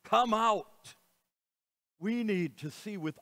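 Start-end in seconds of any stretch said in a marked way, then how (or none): 0.0-0.9 Lazarus, come out. cried out high and loud; a commanding call into the tomb; loud cry. Acted. Preacher voicing Jesus calling Lazarus out, loud cry. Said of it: "as he cried out loudly".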